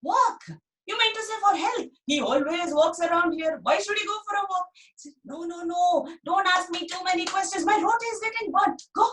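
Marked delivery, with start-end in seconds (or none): 0.0-4.7 put-on high, pleading child's voice; eager little boy. Dhani pestering his busy mother about the meeting. 5.2-9.1 flustered mother. Acted. Busy mother shooing Dhani off to fetch potatoes. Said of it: "she said, and chased him off".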